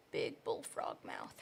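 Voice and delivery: very deep, slow croaky bullfrog. Pretend. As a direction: growl it low like a bullfrog. Drawing a bullfrog.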